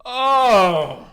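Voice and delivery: high, loud shocked "oh"; shocked outburst. Real, not acted. Co-host learns she's from Boston, shocked outburst. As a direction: cry out at a scandalous reveal. Shocked by where she was born.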